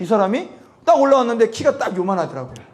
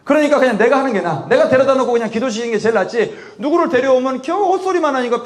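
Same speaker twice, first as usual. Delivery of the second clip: normal talking voice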